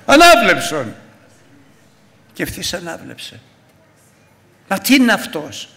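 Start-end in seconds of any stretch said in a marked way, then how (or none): 0.1-1.0 commanding word. Retold or imitated. Jesus commands healing.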